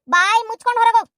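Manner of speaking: repeating the command to get out, now angrier. Acted. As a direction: push her out the door with a sharper ಬಾಯಿ ಮುಚ್ಕೊಂಡು ಹೊರಗೋಗ್. Repeats the order louder.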